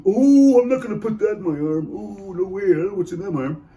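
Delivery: loud high scoffing "Oh" that settles into a mocking whine; hammed-up doubter waving off the needle; conspiracy skeptic. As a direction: lampoon the anti-vaxxer refusing the shot. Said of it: "you know these conspiracy ones?".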